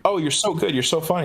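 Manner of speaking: lightly mock an adoring fan; gushing fan. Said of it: "where it's like".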